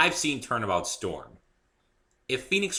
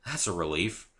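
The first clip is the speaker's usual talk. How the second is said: ordinary delivery, nothing marked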